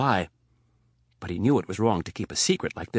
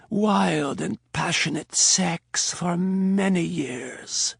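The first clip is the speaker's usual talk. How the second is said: slow, slurred, drugged voice; slurred words; 8 semitones higher, much slower than usual. Acted. Donatella on morphine confessing, slow and slurred. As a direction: let the words drift and smear. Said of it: "Donatella slurred her words".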